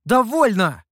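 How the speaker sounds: high, loud outburst; shouting. A narrator's reading. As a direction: cry out to cut them off. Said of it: "снова закричал Сяо Ту".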